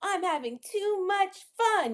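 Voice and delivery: high, carefree storybook voice; playful silliness. Acted. Teacher reads about being called silly, high and playful. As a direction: toss it off high and sassy.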